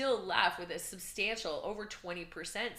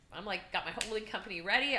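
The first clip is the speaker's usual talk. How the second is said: normal talking voice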